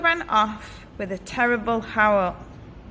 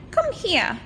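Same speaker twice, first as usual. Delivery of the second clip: sinister witch; 9 semitones higher than usual. Acted. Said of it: "said the witch with a sinister grin".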